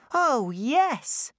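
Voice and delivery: put-on high, bright child voice; little girl voice. A narrator's reading. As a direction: speak as young Clara. Clara speaks.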